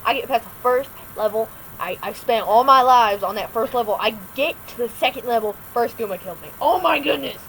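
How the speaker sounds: loud, worked-up gamer complaint; exasperated rant. Real, not acted. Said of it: "let me rant".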